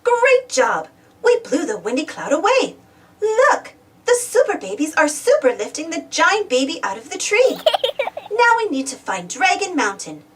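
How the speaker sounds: plain reading voice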